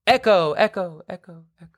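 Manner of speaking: repeating fading echo effect; digital echo. Acted. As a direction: let the word echo away. Episode title echo.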